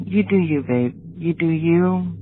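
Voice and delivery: ordinary talk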